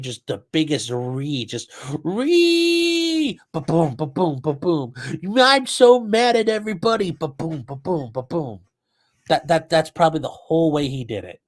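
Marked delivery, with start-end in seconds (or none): 1.4-4.9 rhythmic thudding ba-boom noises; mock crash noises. Streamer imagining the car hitting the crowd, rhythmic mock thuds. 5.4-7.1 mock him as a sulking big baby; whiny mock tantrum. Streamer mocking the defendant's tantrum, high whiny crybaby voice. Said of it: "drove through that crowd in his mind". 7.1-8.6 quieter rhythmic ba-boom thuds, falling; soft mock crash noises. Streamer imagining the car hitting the crowd, fading mock thuds.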